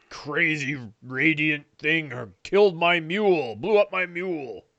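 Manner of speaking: put-on creature voice; grumbling ogre. Ogre complains about his dead mule, grumbling creature voice.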